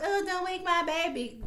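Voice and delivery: high-pitched mimicry of a precious mother; mock fussing mama. Mocking Laurel playing the mama card, fussy imitation. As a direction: make fun of her playing the mama card. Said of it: "she always gotta play the mama card".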